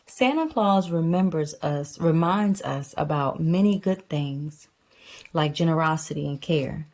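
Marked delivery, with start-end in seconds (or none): none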